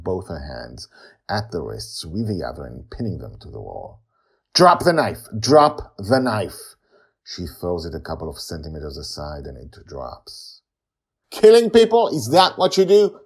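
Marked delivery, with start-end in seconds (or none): 4.5-6.8 loud, high urgent order; shouted command. A narrator's reading. Father pinning the girl with knife. 11.3-13.2 high, loud accusing scream; screaming at her. A narrator's reading. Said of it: "he screams at her".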